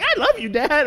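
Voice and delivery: put-on high sweet child voice; boy scout to his dad. Boy scout telling his father he loves him, high child voice. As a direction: lightly voice a little boy. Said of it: "they're all sitting in front of me like".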